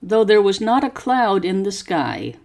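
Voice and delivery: slow, careful story reading for students to repeat; slow model reading. Shown as a demonstration. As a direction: pause after each phrase and lift the tune clearly. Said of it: "Copy how my voice goes up and down".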